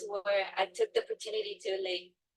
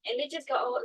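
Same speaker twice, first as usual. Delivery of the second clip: ordinary talk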